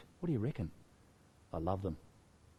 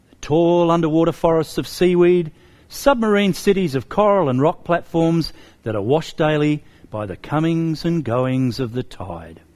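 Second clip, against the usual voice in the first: story reading; 4 semitones higher, 12 dB louder than usual. A narrator's reading. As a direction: read aloud to children with big lifted emphasis. Reads picture book to children.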